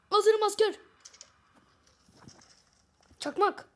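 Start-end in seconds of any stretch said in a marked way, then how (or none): 0.1-0.7 loud, hyped call of readiness; excited shout. Real, not acted. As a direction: shout it like rallying troops. Hyped gamer shouting ready.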